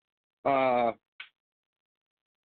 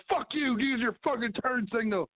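fake-yell at a bad driver in a much higher voice; mock angry yell; 8 semitones higher than usual. Host acting out yelling at a driver, high mock anger. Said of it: "And you're like".

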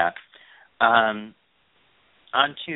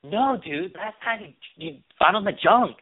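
high mock dude voice; 9 semitones higher than usual. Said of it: "his friend was like".

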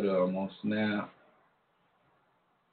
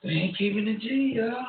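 high exclaim; 12 semitones higher, 3 dB louder than usual. Real, not acted. Exclaims about rappers' feud.

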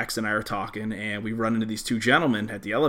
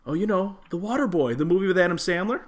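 higher, eager voice of the guy; imitating the guy; 8 semitones higher than usual. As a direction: chirp it like the clueless guy. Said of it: "he's like".

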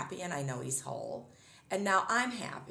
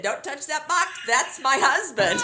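urgent warning; 8 semitones higher, 9 dB louder than usual. Retold or imitated. Retold warning to the movers.

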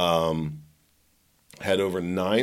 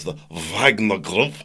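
slow, mock Russian pronunciation; fake Russian accent. Host mocks newscasters saying foreign names, fake Russian accent. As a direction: put on a phony Russian accent. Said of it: "that'll be like the fake Russian accents".

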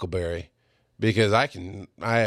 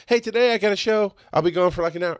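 higher, breezy mock announcement; acted-out everyday update, pitched up; casual heads-up; 9 semitones higher than usual. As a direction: toss it off like a quick heads-up at home.

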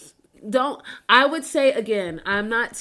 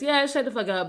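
normal talking voice

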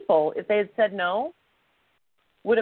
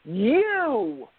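pointed call-out; 6 semitones higher than usual. Retold or imitated. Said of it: "he points at me and says".

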